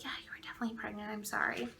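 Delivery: rapid, whispered nurse imitation; hushed apology. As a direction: whisper it confidentially, then apologize softly. Nurse's confidential apology, retold.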